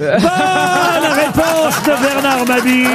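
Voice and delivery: high, drawn-out game-show shout; triumphant announcement. Real, not acted. Quiz host hailing a panellist's right answer, triumphant shout. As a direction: proclaim the winning answer, stretching it out.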